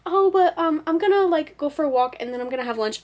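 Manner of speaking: high, loud start rushing into excuses; acted procrastinator protest, tumbling out; stalling inner voice. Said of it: "the thoughts would get more, like, closer together".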